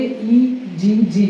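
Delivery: "ई जी जी" letter by letter; letters spelled separately. Shown as a demonstration. Teacher spelling egg without saying double, "ई जी जी" letter by letter. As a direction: say "ई जी जी" with each letter on its own. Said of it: "double जी नहीं बोलेंगे".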